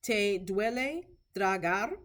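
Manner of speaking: "¿Te duele tragar?" slow and careful, rising at the end; rising question tone. Shown as a demonstration. Teacher showing clinicians how to ask, "tragar?" rising slowly. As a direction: model the question, lifting "tragar?" upward.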